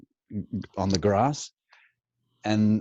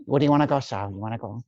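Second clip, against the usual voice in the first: higher, fast Pacino-style street voice; Al Pacino impression; 5 semitones higher, much faster than usual. Teen imitating Pacino bargaining with Sal, fast and edgy. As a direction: do Pacino in Dog Day Afternoon. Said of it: "Al Pacino in Dog Day Afternoon".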